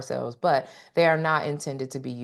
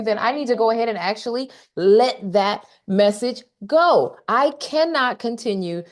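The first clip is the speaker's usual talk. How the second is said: animated high pep talk; 9 semitones higher than usual. Real, not acted.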